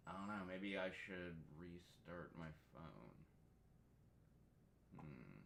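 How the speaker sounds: low creaky voice with vocal fry. Real, not acted. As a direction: let the voice crackle into fry at the bottom. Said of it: "Why do I sound like I have vocal fry?".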